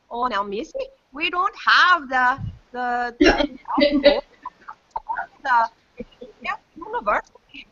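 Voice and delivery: bad South Dakota accent, stretching "we don't have the". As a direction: imitate a folksy South Dakota clerk, drawn out. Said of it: "in a South Dakota accent".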